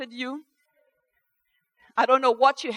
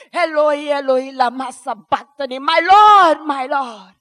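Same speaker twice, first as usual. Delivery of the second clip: crying out; 2 semitones higher, 10 dB louder than usual. Acted. Cries out to God in lament.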